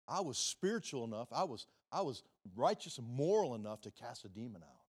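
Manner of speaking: bragging religious man; boastful self-righteous voice. Retold or imitated. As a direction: proudly list your deeds. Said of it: "bring their spiritual resume to Jesus".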